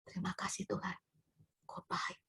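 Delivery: hushed, whispered prayer with long pauses; imitated tearful prayer. Preacher voices a bereaved mother thanking God, hushed tearful prayer. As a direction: whisper thanks as if through grief. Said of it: "dia nangis".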